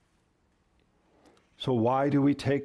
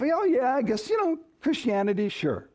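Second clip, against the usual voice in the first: mock half-convinced, starting high and sliding down; vague, noncommittal; 7 semitones higher than usual. Preacher imitating a vague believer, noncommittal. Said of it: "that vague notion of".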